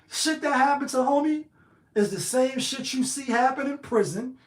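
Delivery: ordinary talk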